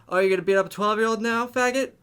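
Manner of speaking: fast, high, loud mocking taunt; taunting comeback. Wished-for comeback taunting the driver, fast loud high jeer. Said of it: "I really wish I would have, like, said that".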